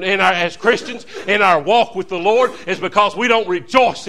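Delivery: loud, driving preaching. Acted. Preacher fired up about joy, loud and driving. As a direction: hammer it home to the church.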